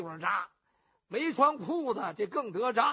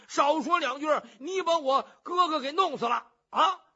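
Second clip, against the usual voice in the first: louder and higher, frantic protest; panicked plea; 6 semitones higher, 7 dB louder than usual. Acted. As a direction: cry out in alarm to stop him. You Junda pleads in panic. (尤俊达急得高声嚷)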